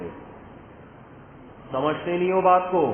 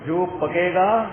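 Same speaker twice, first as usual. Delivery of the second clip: mother's house rule; 3 semitones higher, 5 dB louder, much slower than usual. Retold or imitated. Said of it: "قانون یہ تھا کہ جو پکے گا".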